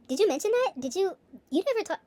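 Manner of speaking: high, loud, fake-surprised "did you mention that?"; mock clueless parent. Mocking a parent who forgot what they were told, high fake surprise. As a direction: play dumb in a shrill mock surprise. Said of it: "you're like".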